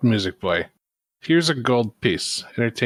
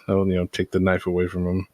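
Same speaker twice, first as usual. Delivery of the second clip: usual speaking voice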